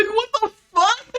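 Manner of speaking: high, loud, laughing what-the-hell; laughing disbelief. Retold or imitated. Telling how she reacted to her boyfriend, laughing outburst. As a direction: blurt it out mid-laugh. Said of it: "I'm like".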